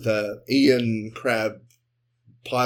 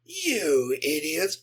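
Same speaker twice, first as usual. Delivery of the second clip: monstrous cry; 3 semitones higher, 4 dB quieter, much slower than usual. Acted. Ian's crab monster transformation.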